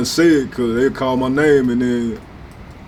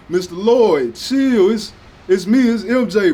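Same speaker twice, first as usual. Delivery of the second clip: higher mock pleading kid voice; a nervous thirteen-year-old begging the dad to chill; pleading teen; 8 semitones higher, slower than usual.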